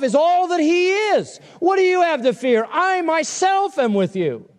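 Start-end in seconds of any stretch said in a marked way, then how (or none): 2.7-4.5 "I myself" slow and high, preached with weight; slow, high preaching voice on "I myself"; emphatic I myself. Acted. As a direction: lift and stretch "I myself" as God's promise. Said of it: "what is implied in "I myself".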